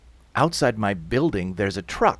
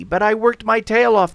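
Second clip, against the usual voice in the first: high, loud, indignant protest; alarmed shouting; 11 semitones higher, 7 dB louder than usual. Acted. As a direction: call out in outraged alarm. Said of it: "they're shouting now".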